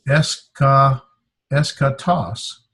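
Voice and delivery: esca- first, then slow full eschatos; careful, drawn-out eschatos; sounded out. Shown as a demonstration. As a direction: build eschatos up in pieces. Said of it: "here's the word we did earlier".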